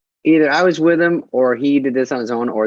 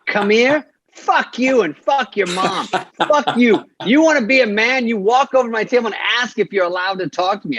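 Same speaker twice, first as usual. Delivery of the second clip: mock Pesci rage; 8 semitones higher than usual. Pesci tells stranger off.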